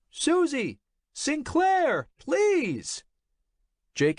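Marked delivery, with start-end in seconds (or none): 0.1-3.0 impatient yelling. A narrator's reading. Jake yells for his ignoring assistant, impatient shout. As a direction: bark her name across the cubicles.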